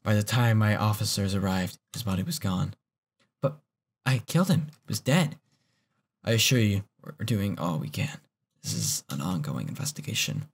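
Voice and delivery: low, steady dialogue read; reading game lines. Acted. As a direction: read the cop's line evenly.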